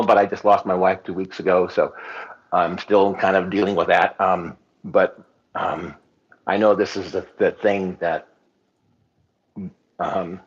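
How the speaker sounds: steadying a shaky voice while grieving; getting emotional. Real, not acted. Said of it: "sorry, I'm getting emotional".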